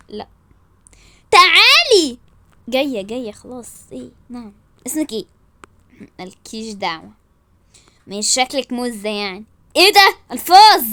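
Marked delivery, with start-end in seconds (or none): 1.3-2.2 loud, higher summons; commanding shout. Acted. Woman summons her rival to come, shouting angrily. 9.8-10.8 shocked outcry. Acted. Shocked at rude language.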